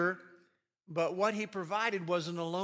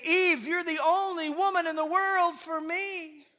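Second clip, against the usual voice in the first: loud and much higher, gushing; excited declaration; 10 semitones higher, 7 dB louder than usual. Acted. Adam telling Eve she is the only one, thrilled. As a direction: declare it with delight. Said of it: "He was saying".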